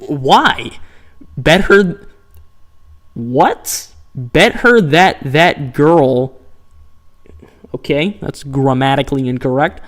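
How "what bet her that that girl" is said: normal talking voice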